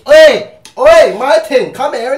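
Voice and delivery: loud, high mock catcall; imitating a cocky street come-on; calling out. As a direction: shout over to her with swagger. Acted guy calling out to woman.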